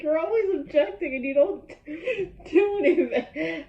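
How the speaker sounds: high, loud quote breaking into laughter; laughing retelling. Retold or imitated. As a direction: crack up while mimicking her complaint. Cracks up retelling funny scene.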